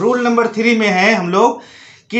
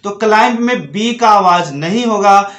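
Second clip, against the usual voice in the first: usual speaking voice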